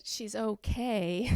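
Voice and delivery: slow, hesitant faint praise; half-hearted, drawn-out compliment; trying to say it nicely. Retold or imitated. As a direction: drag it out, reluctantly tactful. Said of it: "How do you say that nicely?".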